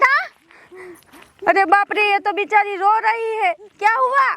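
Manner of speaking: usual speaking voice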